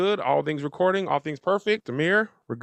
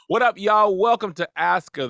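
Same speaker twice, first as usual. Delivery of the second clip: high, loud hype opener for the audience; show host intro; 5 semitones higher, 4 dB louder than usual. Acted. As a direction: greet the viewers like a show host kicking off. Second try at show intro.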